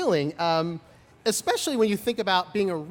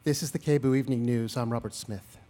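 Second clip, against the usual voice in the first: a stiff, lower newsreader read in vague British English; faked British newsreader voice, a touch lower; vague British accent; 6 semitones lower than usual. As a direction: put on a would-be British accent for the evening news. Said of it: "trying to do a vague British accent".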